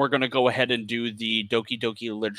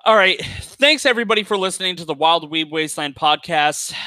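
high, loud hype sign-off; announcer voice; 5 semitones higher, 4 dB louder than usual. Acted. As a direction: announce the show outro. Podcast outro sign-off.

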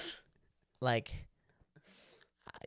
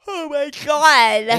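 "oh my God" said through a long, high yawn; 13 dB louder, much slower than usual. Real, not acted. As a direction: yawn your way through the words. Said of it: "for the yawn there".

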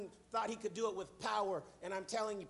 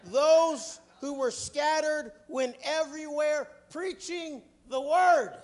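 loud, slow scripture preaching; booming pulpit reading; 4 semitones higher, 10 dB louder, slower than usual. Acted. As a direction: proclaim it from the pulpit, drawn out. Reads scripture in preaching cadence.

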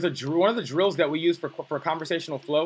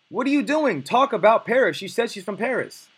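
higher and louder, scolding the guy who missed it; mock exasperated yell; 7 semitones higher, 5 dB louder than usual. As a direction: call the guy out in disbelief. Coach scolds the clueless guy.

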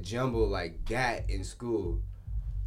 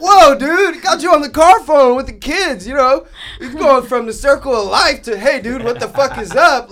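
much higher, louder, laughing disbelief; laughing shocked exclamation; 17 dB louder than usual. Retold or imitated. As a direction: blurt it out half laughing in shock. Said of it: "I'm like".

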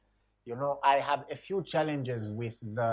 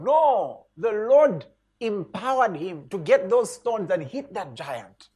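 louder, higher, firm rebuttal; forceful preaching; 5 semitones higher, 6 dB louder, slower than usual. Acted. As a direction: hit back with conviction. Preacher rebuts passive attitude.

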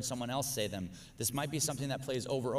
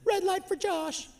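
high, far-off voice springing the trap; gleeful prankster. Retold or imitated. The imagined guy with the camera, high gleeful gotcha. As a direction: squeak it out like a sneaky game host. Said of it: "goes, 'Oh, watch this".

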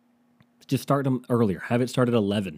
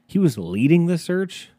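incredulous; 8 semitones higher, 5 dB louder than usual. Real, not acted. Incredulous at news item.

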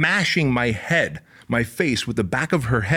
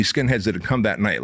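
ordinary talk

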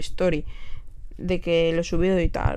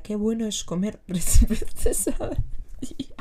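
whispered quote broken by laughter; giggling imitation. Retelling the singer's line, laughing in a whisper. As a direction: try to repeat it but crack up. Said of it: "pero decía".

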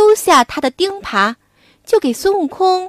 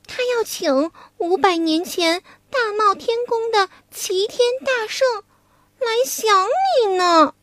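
coy maiden; 6 semitones higher, 4 dB quieter than usual. Acted. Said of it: "Wukong playing Cuilan".

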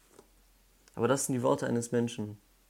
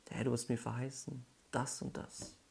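quiet prayer; 9 dB quieter than usual. Retold or imitated. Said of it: "das tut David hier so ein bisschen".

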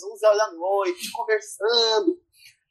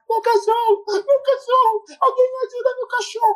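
high wailing mock plea, much higher; distraught dog owner. The dog's owner wailing for help in the street, high and frantic.

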